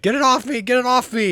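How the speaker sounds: high, loud frantic scream; acted shriek of alarm; panicked yelling. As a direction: yell for someone to get it off you. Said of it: "some yelling and screaming".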